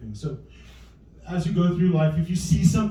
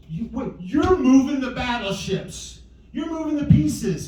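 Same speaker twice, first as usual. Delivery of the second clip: high-pitched, stretched disbelief; retold dad's incredulous outcry; mock indignant accusation; 9 semitones higher, 3 dB louder than usual. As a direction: catch a cheat in comic protest. Dad catches daughter cheating.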